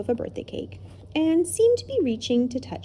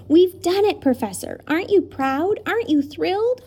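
put-on excited boy; 8 semitones higher, 4 dB louder than usual. Ben cheering professor.

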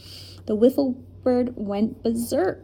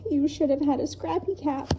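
higher, choked little voice; 5 semitones higher than usual. A narrator's reading. Lindy to the professor before bursting into tears, mildly choked. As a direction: hold back tears as you speak. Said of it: "said in a choked voice".

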